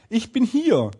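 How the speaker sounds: calling out. A narrator's reading. Tiny tailor answering from inside the cow, calling out. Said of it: "und er rief".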